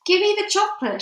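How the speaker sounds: put-on high, loud boyish shout; shouted demand. A narrator's reading. Bruno shouts at the witch for his chocolate. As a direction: yell it like a suspicious kid. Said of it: "shouted Bruno".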